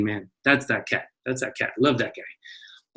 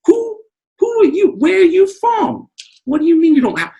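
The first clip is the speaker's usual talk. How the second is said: mock outrage; 5 dB louder than usual. Mock outrage at not knowing jukebox.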